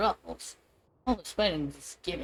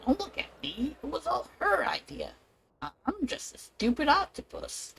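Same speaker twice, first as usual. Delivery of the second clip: pleading octopus; 7 semitones higher, 4 dB quieter than usual. Acted. Octopus character shifts blame.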